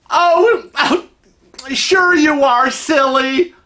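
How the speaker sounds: high, loud, teasing brush-off; playfully dismissive lady. Acted. Lady won't believe he is not Jerry, playfully dismissive. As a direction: laugh off the denial in a high, gushy voice.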